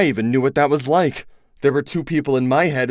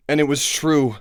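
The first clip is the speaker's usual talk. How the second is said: plain reading voice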